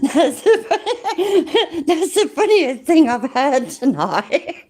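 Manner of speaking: slow words broken up by laughter; laughing hard. Real, not acted. Streamer cracking up at the funniest thing tonight, laughing. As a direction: struggle to speak through real laughter.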